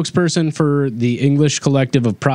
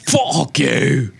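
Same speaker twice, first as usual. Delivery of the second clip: mock English; 6 semitones higher, much slower than usual. Mock British swearing bit.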